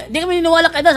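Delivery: lightly hammed-up atheist retort; mock atheists' voice. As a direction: mimic the scoffing unbelievers. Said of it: "sabi ng mga atheist".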